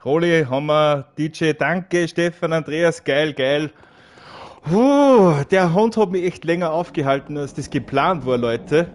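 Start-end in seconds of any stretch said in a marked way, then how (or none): 4.6-5.4 high, loud puff of relief; loud relieved exhale. Real, not acted. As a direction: blow out the tension. Relief after the boss fight.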